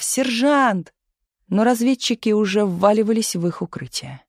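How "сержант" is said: high, drawn-out calling out; urgent cry. A narrator's reading. Tumanov calls Misha back as shelling starts, crying out. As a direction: call someone back urgently, no great volume. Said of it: "закричал Туманов".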